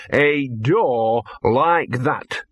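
drawn-out ominous dog warning; slow ominous dog voice. Acted. Dog warns Victor about the door, drawn out slow. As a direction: Gaspode lingering on each word.

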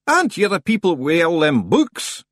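loud, high-pitched rustic demand; demanding stranger. A narrator's reading. Stranger questioning the librarians, loud and demanding. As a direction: demand it loudly as the odd figure. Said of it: "the figure demanded".

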